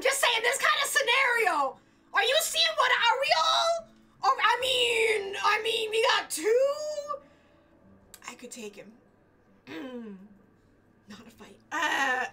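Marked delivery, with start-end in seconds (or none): 0.0-7.2 high, loud, drawn-out questions; excited outburst. Real, not acted. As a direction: build into an incredulous squeal. Excited reaction to game scenario.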